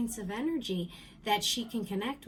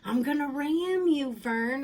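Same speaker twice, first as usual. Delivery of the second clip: loud, high teasing threat; mom's saying; 5 semitones higher, 8 dB louder than usual. Retold or imitated. Mom getting mad or joking with kids, loud mock threat. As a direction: call it out like mom's mock warning. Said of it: "she used to say".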